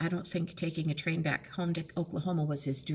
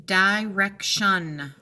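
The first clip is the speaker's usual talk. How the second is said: drawn out in syllables; slow syllable split; 6 dB louder, much slower than usual. Shown as a demonstration. Teacher to students, slowly sounding out syllables. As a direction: stretch each syllable for the class. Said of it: "keeping that shun together".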